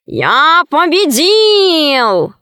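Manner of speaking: very drawn-out, high victory crow; triumphant cat cry. A narrator's reading. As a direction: crow slowly in a put-on cat voice. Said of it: "закричал кот". (торжествующий вопль кота)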